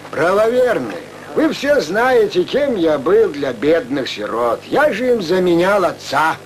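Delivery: ordinary talk, nothing marked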